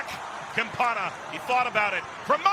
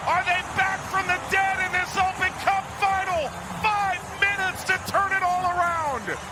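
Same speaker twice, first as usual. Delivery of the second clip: long goal yell; 9 semitones higher than usual. Real, not acted. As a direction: scream the scorer's name, then build. Late equalizer goal call.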